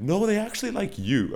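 high, mock-persuasive stripper voice; coaxing stripper. Acting a stripper trying to make a customer believe, high and coaxing. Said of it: "every stripper is trying to make you believe".

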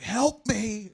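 high, loud cry for help; retold yell. Insisting he yelled at sleeping Josh, mock yelling. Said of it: "I swore I was yelling at him".

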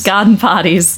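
put-on Boston Irish accent. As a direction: echo it Boston Irish style, gahden pahties. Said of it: "very thick Boston Irish accent".

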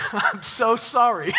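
real laugh through a drawn-out apology. Real, not acted. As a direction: apologize slowly while cracking up. Joking apology after teasing Arkansas people.

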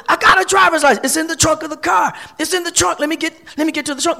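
high-pitched, insistent pleading without full volume; retold hollering. Driver protesting to officers cuffing him, mock hollering. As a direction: imitate a man hollering, high and hurried. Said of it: "he's constantly hollering".

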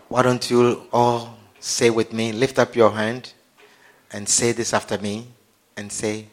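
low, soft prayer voice; leading prayer. Acted. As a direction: lead them quietly, phrase by phrase. Leads congregation in prayer.

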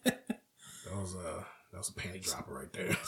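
sly hushed whisper; a conspiratorial whisper. Real, not acted. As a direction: lean in and whisper it.

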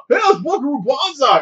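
high, excited "hey" of recognition; excited fan. Retold or imitated. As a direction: gush like a starstruck fan. Fans recognizing the hero.

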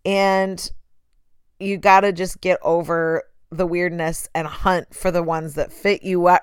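normal talking voice

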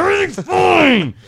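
mock-defensive bellow; defensive shout. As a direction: yell it like a guilty husband covering up. Defensive joke about his marriage.

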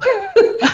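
quick high burst of laughter; short laugh. Real, not acted. Guest laughing about her tiny country. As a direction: laugh it off lightly.

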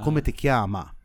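"chiama" ending on a clear open A; female form. Shown as a demonstration. Teaching the female form of the question, ending "chiama" on a clear A. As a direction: stress the final A of "chiama". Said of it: "use the A at the end".

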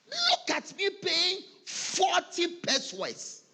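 emphatic preacher boast. Real, not acted. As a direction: show off proudly to the church. Preacher boasting of his tithe.

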